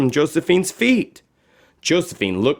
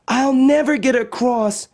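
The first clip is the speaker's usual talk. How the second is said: put-on high, small voice of a worried girl; Josephine despairing; 12 semitones higher than usual. Girl facing a snowy mountain, higher and despairing. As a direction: sound defeated at the foot of a mountain. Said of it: "she said".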